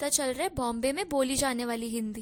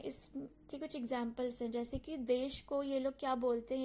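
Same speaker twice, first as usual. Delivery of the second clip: normal talking voice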